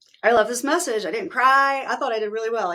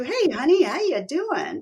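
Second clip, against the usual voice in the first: high, louder mock-sweet greeting from the abuser; imitating sudden sweetness; 7 semitones higher, 4 dB louder, slower than usual. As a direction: play the sugary switch. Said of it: "like a different person".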